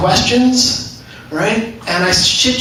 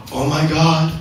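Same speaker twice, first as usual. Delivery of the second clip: put-on labourer voice, drawn out at the end; mock awe, stretching the last words; Mexican day labourers; much slower than usual. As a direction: react like an awed worker, slowly. Quoting fifty Mexican day labourers.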